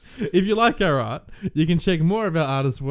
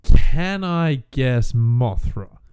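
drawn-out guess; 5 semitones lower, 6 dB louder, much slower than usual. Pretend. Slow drawn-out joking guess.

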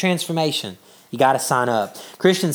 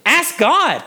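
higher-pitched, punchy urging; emphatic exhortation; 8 semitones higher than usual. Acted. Preacher quoting James to the congregation, emphatic exhortation. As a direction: urge it as the obvious answer.

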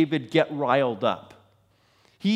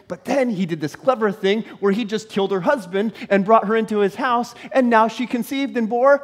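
ordinary delivery

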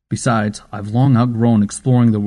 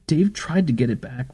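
put-on small boy, rising higher into a whisper; animated child; 5 semitones higher than usual. A narrator's reading. Boy to grandmother about bullies, lightly animated child voice. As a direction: let the child get more animated, then whisper the end. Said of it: "The boy's voice became more animated".

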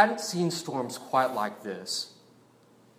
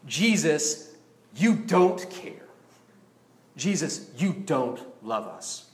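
ordinary delivery

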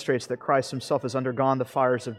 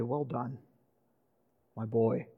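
soft, quiet, gentle approval; like a father quietly proud of his son; tender father's praise; 3 semitones lower, 8 dB quieter than usual. Pretend. Preacher voicing the heavenly Father praising a child, soft and tender.

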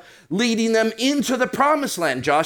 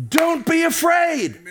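commanding shout; 6 semitones higher than usual. Real, not acted. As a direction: thunder it out as God's command. Rachel, he's commanding us.

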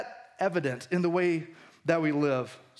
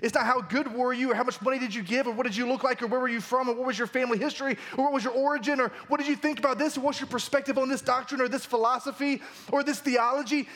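usual speaking voice

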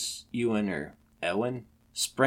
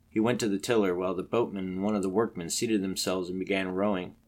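ordinary delivery, nothing marked

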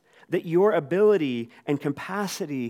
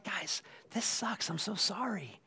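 mock sympathy; 6 semitones higher, 10 dB quieter than usual. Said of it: "this kind of mere empathy".

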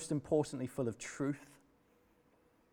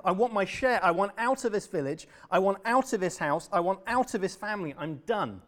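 furious demand; 6 semitones higher, 7 dB louder than usual. Acted.

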